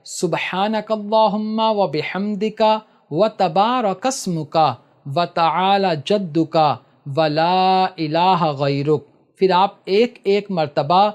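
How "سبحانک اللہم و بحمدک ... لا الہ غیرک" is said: slow, measured prayer recitation; calm slow prayer reading. Shown as a demonstration. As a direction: recite each phrase slowly, pausing between. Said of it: "ایک مرتبہ ثنا پڑھنا ہے".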